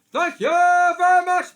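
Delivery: high, loud shout; shouted comic sign-off. Acted. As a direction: yell it to the crowd. Catchphrase shout.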